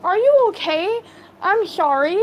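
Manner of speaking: high, loud worried apology; concerned nurse. Retold or imitated. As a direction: nurse rushing to check he's hurt. Nurse checks on burnt Colton.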